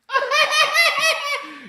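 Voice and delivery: high-pitched loud cackling burst; loud laugh. Real, not acted. Host cracking up on his own stream, loud laugh. Cracking up mid livestream ramble.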